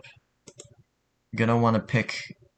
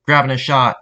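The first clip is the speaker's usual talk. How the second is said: loud, raised game callout; projected in-game callout; voice line recording; 5 semitones higher, 10 dB louder than usual. Shown as a demonstration. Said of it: "you might want to re-record".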